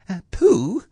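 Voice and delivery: high, hesitant little voice; timid Piglet. A narrator's reading. Piglet gets Pooh's attention, a little timidly. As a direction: venture it shyly, pitched high. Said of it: "a little timidly".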